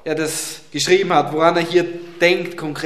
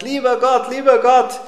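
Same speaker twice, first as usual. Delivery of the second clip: put-on higher child voice calling loud to God; boy praying loudly for a bike at bedtime, higher and louder child voice; little boy shouting a prayer; 7 semitones higher, 4 dB louder than usual. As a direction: play a small boy yelling his bedtime prayer. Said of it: "ganz laut zum Herrn schreit".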